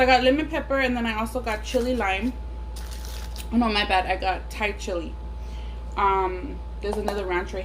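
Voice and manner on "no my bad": normal talking voice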